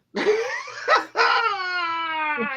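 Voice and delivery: high, helpless laughter; high cackling laugh at his own mishap. Real, not acted. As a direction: laugh until it almost cries.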